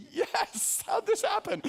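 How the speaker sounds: very high-pitched squeaky delight; mock giddy disbelief. Groom watching his wife walk down the aisle, mock giddy disbelief. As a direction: play an overjoyed groom in falsetto. Acts out his wedding-day reaction.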